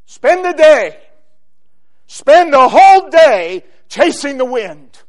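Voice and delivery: loud, high exhortation; full-force preaching. Real, not acted. Preacher sending listeners after the wind, full-force preaching. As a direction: call it out forcefully to the whole room.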